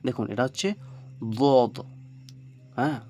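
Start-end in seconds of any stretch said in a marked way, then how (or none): 1.4-1.8 বদ with a heavy, emphatic ظ-like sound. Shown as a demonstration. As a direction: voice বদ thick and heavy, back tongue raised. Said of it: "ভারী হচ্ছে না আওয়াজটা".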